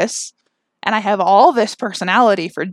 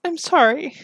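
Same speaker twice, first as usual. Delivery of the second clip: choked up, pitched higher; tearful apology; 6 semitones higher than usual. Real, not acted. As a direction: apologise through rising tears. Apologises after long emotional pause.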